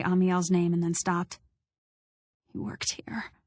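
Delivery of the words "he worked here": faint unvoiced whisper. A narrator's reading. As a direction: barely breathe it out. Hoarse whisper to firefighter.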